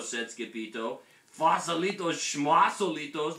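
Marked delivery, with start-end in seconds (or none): 1.4-3.4 high, loud scoff of a tiny storybook dog; dismissive declaration. A narrator's reading. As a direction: wave it away loudly. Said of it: "declared Poquito Tito".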